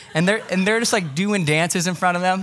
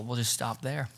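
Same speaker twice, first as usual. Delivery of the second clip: normal talking voice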